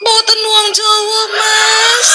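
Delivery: high, slow singsong Javanese wife; sustained high notes, hammed-up refined courtesy; Sri Rezeki. Wife wakes husband in revenge, high droning mock-Javanese sweetness. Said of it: "kenapa ada Sri Rezeki di sini".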